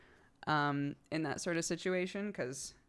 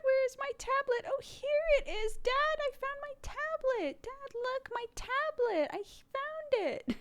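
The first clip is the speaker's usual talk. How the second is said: put-on much higher, slow child voice; imitating a little kid. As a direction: squeak like a small child asking Dad. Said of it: "Can you hear Clark?".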